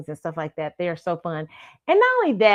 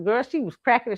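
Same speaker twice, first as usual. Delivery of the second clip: ordinary talk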